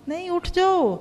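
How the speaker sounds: insistent. Acted. Telling someone to get up.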